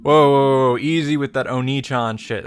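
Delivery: ordinary talk, nothing marked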